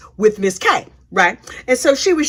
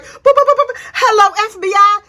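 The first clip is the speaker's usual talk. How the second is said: high, loud mock button beeps then hello; phone dialing beeps; 9 semitones higher, 4 dB louder than usual. [name] dialing the FBI, high playful beeps then hello. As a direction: beep out the phone dial playfully.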